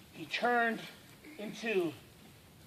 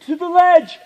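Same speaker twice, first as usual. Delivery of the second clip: high, loud leaping cry; sudden full-voiced shout; yelled; 7 semitones higher, 10 dB louder than usual. A narrator's reading. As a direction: yell it out as you leap. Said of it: "And he yelled".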